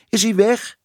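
high, anxious question called from above. A narrator's reading. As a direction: call down high and worried. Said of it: "riep het hoofd". (angstig, hoog geroepen)

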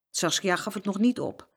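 ordinary delivery, nothing marked

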